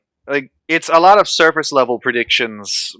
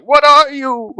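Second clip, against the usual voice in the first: high squeaky mock horror at own reflection; a sudden high shriek of disbelief; mock horrified; 12 semitones higher, 3 dB louder, slower than usual. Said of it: "looking in the mirror going like".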